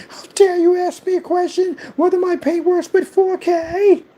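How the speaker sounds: high-pitched indignant parody; indignant mock Karen. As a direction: ham up an offended snob. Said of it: "they snap on you".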